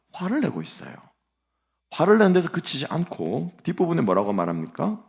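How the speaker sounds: ordinary talk, nothing marked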